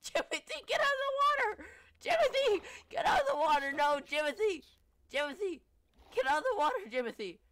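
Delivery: frantic high panicked yelling; lifeguard shouting warnings. Pretend. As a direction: scream desperate warnings across the water. Frantic calls to horse in water.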